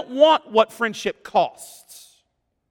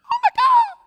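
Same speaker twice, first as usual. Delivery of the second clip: high gasp then shouted oh my God; hammed-up gasp of awe; mock excited gasp; faster than usual. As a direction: gasp and blurt it out like a starstruck fan. Mocking the world's excitement.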